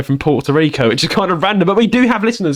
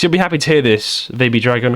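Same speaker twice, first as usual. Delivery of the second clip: ordinary talk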